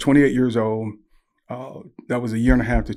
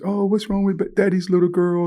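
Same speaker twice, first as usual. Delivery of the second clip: put-on high, tender coo to a little girl; cooing father; 8 semitones higher than usual. Dad to his crying little girl, cooing. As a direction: imitate a dad babying his upset daughter.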